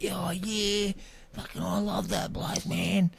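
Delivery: high, dopey stoner drawl; spaced-out stranger. Retold or imitated. Voicing the rambling stranger met in the city, dopey high drawl. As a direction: play him as a dazed, rambling bloke.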